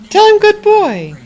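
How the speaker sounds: high sing-song dog praise; talking to a dog. Real, not acted. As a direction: coo it up high to the pet. Urging partner to praise dog.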